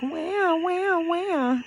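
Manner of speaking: high whiny fake wah wah; whimpering like a crybaby; mock crying. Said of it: "don't think I'm saying that like".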